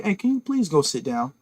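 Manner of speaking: soft, calm request. Shown as a demonstration. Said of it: "I can say that calmly".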